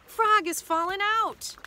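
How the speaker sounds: high-pitched storybook alarm; alarmed cry. A narrator's reading. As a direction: raise the alarm. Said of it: "screams Sheep".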